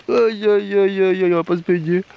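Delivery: long drawn-out, high and loud lament; dismayed groan. Real, not acted. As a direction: wail it out in dismay at the rain. Dépité par la pluie, pas de baignade. (lamentation aiguë et traînée)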